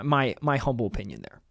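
normal talking voice

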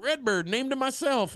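high, slow put-on character voice; imitated parrot handler. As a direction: draw it out high, mocking the handler. Retold parrot handler answering proudly.

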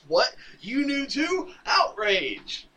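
high-pitched, loud mock outrage; pissed off. Damian learning Batman knew too, hammed-up pissed-off outrage. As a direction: blurt it out in hammed-up indignation. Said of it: "pisses off Damian even more".

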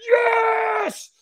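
very high, drawn-out triumphant scream; all-out yell. Retold or imitated. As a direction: scream it with arms thrown in the air. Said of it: "threw my arms in the air and I went".

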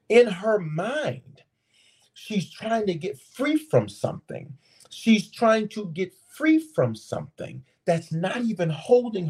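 high-pitched, urgent sermon delivery; impassioned preacher pleading with listeners; fervent preaching. Real, not acted. Preaches the point intensely.

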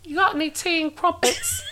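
high, hammed-up British; fake British accent. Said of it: "wish we had a British accent".

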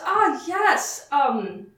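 mayor forcing patience with the returning adventurer; repressed sigh. Acted. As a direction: greet him while swallowing a sigh. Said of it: "he represses a sigh actively".

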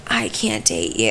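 dismissive guy. Retold or imitated. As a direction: drop way down into a dumb bro voice. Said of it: "he'd be like".

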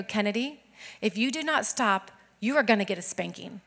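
low, soft, measured parental threat; stern quiet warning. Retold or imitated. Mom warns daughter who is not listening, stern warning. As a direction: lay down the warning quietly and evenly. Mother voiced warning disobedient child.

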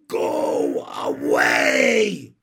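very loud, hugely drawn-out bellow; slow, full-force holler; shouted order. A narrator's reading. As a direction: stretch each word into a long commanding yell. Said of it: "ordered Ramona".

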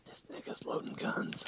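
soft breathy whisper, no voice; mock ASMR whisper.

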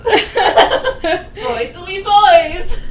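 drawn out through laughter; laughing. Real, not acted. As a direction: stretch it slowly while laughing. Laughing over a joke.

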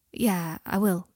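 noncommittal, mildly vague assent. A narrator's reading. Harry told to order food, answering vaguely. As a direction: agree without really committing. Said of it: "said Harry, vaguely".

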